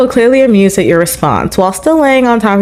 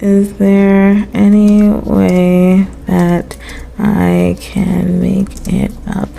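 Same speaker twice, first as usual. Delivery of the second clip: very slow, fading softer; halting in-character delivery; 4 dB quieter, much slower than usual. Acted. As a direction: drag out each word with long pauses, trailing off. Slow in-character plea after outburst.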